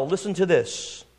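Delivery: normal talking voice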